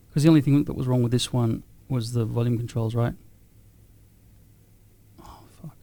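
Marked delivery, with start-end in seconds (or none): 5.2-5.8 whispered curse. Real, not acted. Muttered curse at a slip.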